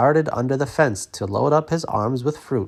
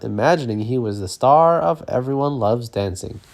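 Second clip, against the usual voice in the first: ordinary delivery, nothing marked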